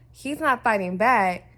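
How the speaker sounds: slow and loud, leaning on not; emphatic. Real, not acted. Vlogger insisting to viewers, slow and loud. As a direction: spell out not, slow and hard. Hammering the point.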